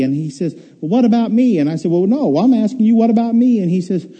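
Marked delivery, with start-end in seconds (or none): 0.8-1.6 higher-pitched retort in an acted argument; lightly indignant comeback, pitched up; mock retort. Acted quarrel with God. 1.9-3.6 higher-pitched arguing back; mildly indignant insistence, acted out; mock insistence. Acted quarrel with God.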